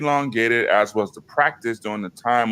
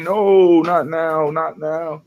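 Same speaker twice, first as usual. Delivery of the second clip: dismayed protest; 6 semitones higher, 4 dB louder, slower than usual. Real, not acted. Battery dying mid-stream.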